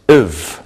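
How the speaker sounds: "iv" with short vowel and voiced V; suffix pronunciation. Shown as a demonstration. Teacher showing students the I V E ending, "iv" with a short vowel. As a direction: say "iv" slowly as a word ending.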